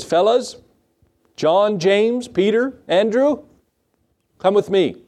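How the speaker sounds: high calling voice across the shore. Retold or imitated. Jesus calling fishermen along the shore, high and calling.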